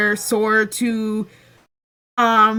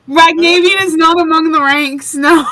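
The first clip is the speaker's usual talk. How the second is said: loud, quick and higher-pitched; loud outburst; 5 semitones higher, 7 dB louder than usual. Acted. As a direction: cry it out over the table, fast. Acted outburst in game scene.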